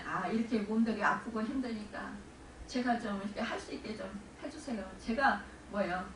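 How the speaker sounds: normal talking voice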